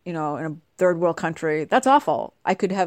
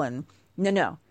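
normal talking voice

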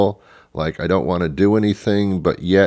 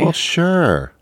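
pitched way up, sarcastic; high mock sure; 10 semitones higher than usual. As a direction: squeak it out sarcastically.

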